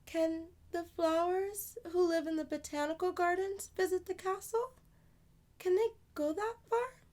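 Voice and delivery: put-on high little girl, sadly. Amani grieving her dead flowers, high childlike voice. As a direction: play a small girl mourning her flowers.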